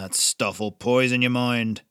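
slow, lightly growled warning; growling old smith. A narrator's reading. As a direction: grumble it like a gruff old man. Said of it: "Jonas growled".

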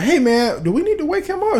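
high, anxious mock plea; retold worried question. Asking a companion about a worrying sleeper, retold high and worried. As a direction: pitch it way up, uneasy and asking.

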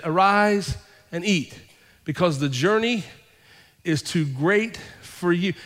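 drawn-out, reverent reading of the angel's words; savoring the verse for the congregation; slow scripture reading. Acted. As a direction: linger on each phrase like a holy message. Reads scripture, slowing.

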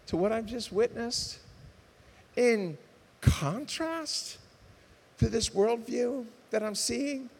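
pleading prayer voice, rising as it builds; earnest prayer. Acted. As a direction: pray it out to God, reaching upward. Preacher prays aloud.

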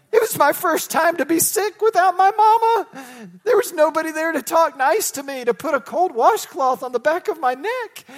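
high, loud mock-crying voice; hammed-up tearful whine. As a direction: wail like a homesick kid, played for laughs. Acting out sick boy missing mama.

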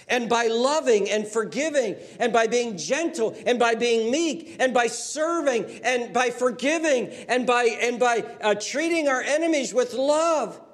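high, loud, ringing pulpit voice building to a peak; full-force preaching. Acted. Preacher rallying his congregation, high and loud preaching. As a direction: preach it out to the whole hall.

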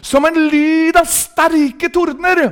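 high, slowly stretched preaching cry; ringing, drawn-out proclamation; proclaiming scripture. Shown as a demonstration. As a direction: draw the verse out high like rolling thunder. Proclaims the thunder verse.

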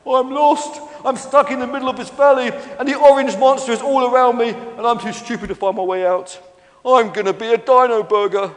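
high, loud, hammed-up despair; crying wail, acted. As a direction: wail like a lost young dinosaur. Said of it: "he began to cry".

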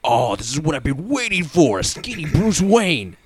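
mock-thrilled, stretching the final words high; excited fan. Host mimicking a friend who loves skinny Bruce Wayne, mock excited. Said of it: "Matt's like".

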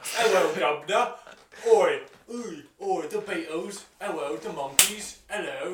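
loud, high cockney caricature; hammed-up English accent. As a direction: holler it like a goofy cartoon Englishman. Shouting as goofy English caricature.

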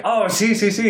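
high-pitched, eager agreement; super excited. Retold or imitated. Man answers in Spanish after "Hablo español", super excited. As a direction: blurt it out, thrilled and high. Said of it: "he's, like, super excited".

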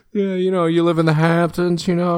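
lightly hammed-up Hamptons socialite; Hamptons accent. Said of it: "do you have a Hamptons accent?".

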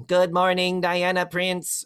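slow, drawn-out machine greeting; computer voice. Retold or imitated.

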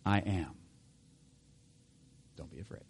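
soft, low and weighty; solemn reveal. Acted. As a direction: let it drop almost to a murmur. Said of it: "what is it that he says to them?".

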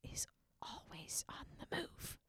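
soft, unvoiced hushed whisper; whispered awe. Real, not acted. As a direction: breathe it like a secret. Hushed awe about Aslan.

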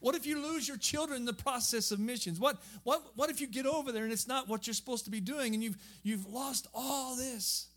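reliving the panic of saying yes, lightly; retold fear. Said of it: "fear had gripped my heart".

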